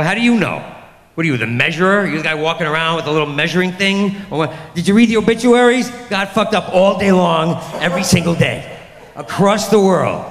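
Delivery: hammed-up exasperated challenge; indignant retort. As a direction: fire back in mock outrage, stretching the big phrases. Angry retort to a platitude.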